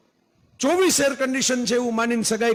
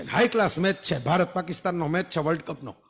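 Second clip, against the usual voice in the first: ordinary delivery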